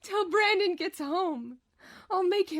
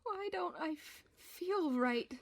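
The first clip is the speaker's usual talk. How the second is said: much softer, fading breath; faint; 10 dB quieter than usual. Acted. Tulpa asking as she disappears, fading. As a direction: fade out on the question.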